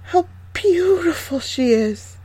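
high, slow dwarf voice full of wonder; admiring dwarfs. A narrator's reading. Dwarfs admire sleeping girl.

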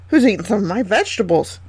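usual speaking voice

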